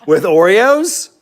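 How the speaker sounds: slow, loud, high-pitched disbelief; incredulous echo. Pretend. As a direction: stretch out each word in mock outrage. Incredulous echo of partner's words.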